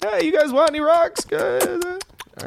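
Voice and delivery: high-pitched street hawker; rock-hawking merchant. Acted. Merchant offers rocks to departing strangers, high-pitched hawking. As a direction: call out your wares way up high.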